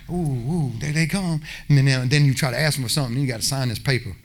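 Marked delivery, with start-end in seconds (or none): 0.1-1.4 mock hiding churchgoer. Churchgoers ducking at Walmart.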